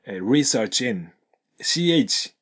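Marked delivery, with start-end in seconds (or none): 0.2-1.1 "research in" said slowly with the ch running into "in" as "chin"; slow linked research in. Shown as a demonstration. Teacher slowly showing the linked sound. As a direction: join "research in" into one flow, "-chin". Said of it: "くっつくと、chin".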